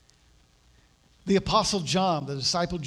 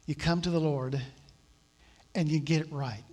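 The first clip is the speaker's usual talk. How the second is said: ordinary talk, nothing marked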